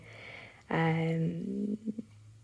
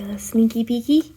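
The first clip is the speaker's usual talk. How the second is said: louder playful whispered tease; teasing whisper; 4 semitones higher, 7 dB louder than usual. Real, not acted. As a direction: dangle the sneaky peeky. Teasing reveal.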